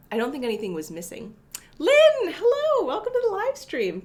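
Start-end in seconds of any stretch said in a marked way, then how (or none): 1.8-4.0 high, loud welcome for a viewer; light up as she spots Lynn in chat; excited greeting. Real, not acted. Streamer greeting Lynn in chat, high excited welcome.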